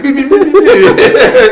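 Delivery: high laughing beep beep; squeaky beeping through laughter; mock beeping. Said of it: "He's going up there like".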